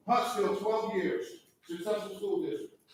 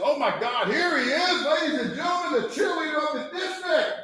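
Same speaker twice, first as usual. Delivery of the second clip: shouted, higher hype announcer intro; 7 semitones higher, 5 dB louder than usual. Retold or imitated. Speaker mocking a colleague's intro, loud announcer voice. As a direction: bellow it like announcing a star.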